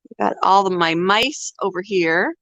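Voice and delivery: ordinary talk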